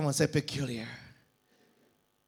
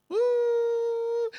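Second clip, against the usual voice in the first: high excited whoop; sudden high cry of excitement. Real, not acted. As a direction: let out a joyful woo. Preacher's exclamation.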